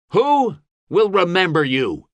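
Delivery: high, ringing question; challenging oratory. A narrator's reading. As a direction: demand it of the crowd.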